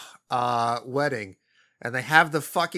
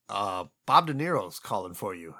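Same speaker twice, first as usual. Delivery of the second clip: lower, flat mock announcement; mock deadpan assistant; 7 semitones lower than usual. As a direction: drop it low and matter-of-fact. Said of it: "he's just like".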